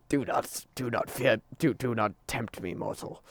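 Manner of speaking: low, soft, menacing demon. Acted. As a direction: speak as a possessed demon. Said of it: "That was terrifying".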